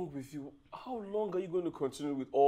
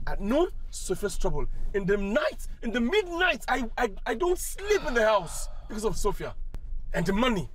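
exasperated complaint; 9 semitones higher than usual. Acted. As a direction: vent about your wife, rising higher. Husband complaining loudly about wife.

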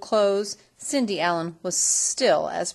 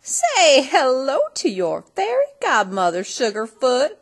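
put-on loud, higher twangy old lady; cowgirl fairy godmother; 5 semitones higher, 8 dB louder than usual. A narrator's reading. Fairy godmother greeting Cindy, loud and sassy. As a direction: play a sassy frontier granny.

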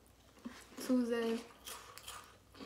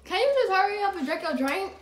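exasperated; 9 semitones higher, 8 dB louder than usual. Real, not acted. Pestering friend to drink.

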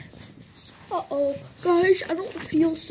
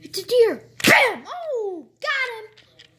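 higher and louder, a sudden thrill at spotting the deer; excited shout; 7 semitones higher, 3 dB louder, slower than usual. Pretend. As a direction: blurt it out as the shot goes off. Spots deer, shoots it.

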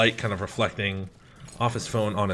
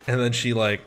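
ordinary talk

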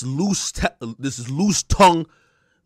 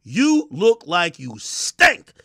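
usual speaking voice

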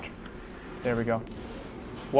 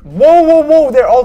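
high, loud startled cry; startled whoa; 13 semitones higher, 13 dB louder, slower than usual. Real, not acted. Gamer startled as animatronics start creeping. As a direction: jolt up in alarm.

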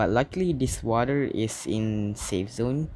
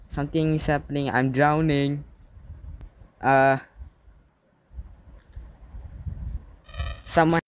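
panicked; 4 semitones higher than usual. Real, not acted. Player drowning, calling for help.

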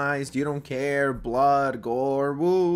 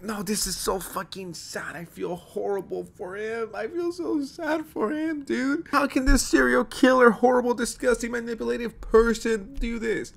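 high, whiny mock of a gushing girlfriend; 9 semitones higher than usual. Mocking a girlfriend who pities the killer, high and whiny. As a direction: ham up an oversympathetic girl, pitched high. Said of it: "my girl being like".

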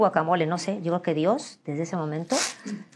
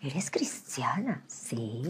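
low breath-only whisper; very soft, slow whispering; acted whisper; 5 semitones lower, 9 dB quieter, much slower than usual. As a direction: whisper it as a hushed exchange. Acted exchange with ex.

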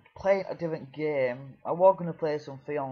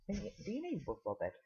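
mock questioning voice; 6 semitones higher, 9 dB quieter, faster than usual. Questioning himself in another voice.

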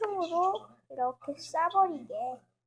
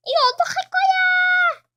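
very high screaming demand; full shout of outrage; furious shout; 14 semitones higher, 12 dB louder than usual. Acted. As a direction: scream at them over the mess.

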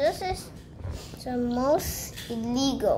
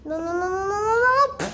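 panicked; 8 semitones higher, 5 dB louder, much faster than usual. Real, not acted. Character falling off tower.

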